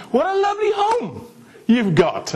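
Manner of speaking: high, sugary flattering voice; overly sweet compliment. Retold or imitated. Trying flattery again on the rich buyer, gushing high. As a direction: lay the charm on thick.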